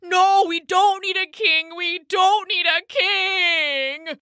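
high bullfrog wail, slowing at the end; desperate cry. A narrator's reading. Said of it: "he cried".

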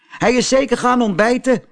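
high, teasing call; a boy jeering at Pinocchio on the beach; calling schoolboy. Acted. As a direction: jeer at the fooled puppet. A boy calls out mocking.

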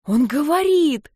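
high, slow, squealing cry; squealing in awe. Acted. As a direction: squeal with delight and fright. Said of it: "Со смесью восторга и страха взвизгнула".

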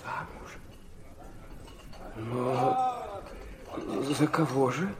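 stunned. Acted. Son stunned by the news.

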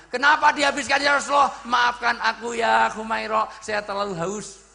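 high, declaimed cry, easing at the end; dramatised loud plea. Acted. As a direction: cry out the apology to a whole hall. Acts out retold dialogue, full force.

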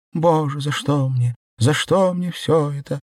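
high, plaintive wail; despairing lament. Acted. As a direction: plead with heaven in mild, high-voiced despair. Белка wails in despair, limping.

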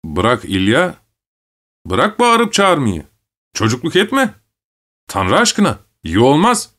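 appeasing plea with lifted pitch; placating. A narrator's reading. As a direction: coax him to calm down. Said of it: "Muhtar alttan almaya başladı".

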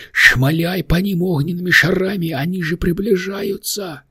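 high-pitched, pressing little demon; urgent creature. A narrator's reading. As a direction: urge him to fire in a high monster voice. Little demon urges Richard to fire.

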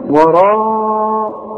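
وراء stretched very long and chanted, with the lips rounded as if for a kiss. Shown as a demonstration. Teacher shows the student how to round the lips. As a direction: sing out وراء very slowly through pursed lips. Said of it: "مد شفايفك على هيئة قبلة".

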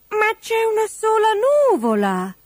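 very high little bird voice; high-pitched naive protest; small protesting bird. A narrator's reading. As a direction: object in a tiny high pipe. Hopeful birds object to the king.

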